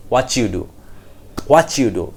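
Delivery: "What you" fused into "whatchu"; native-style linking. Shown as a demonstration. Teacher shows how they will speak, linked. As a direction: make a ch between "What" and "you". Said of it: "they will speak like this".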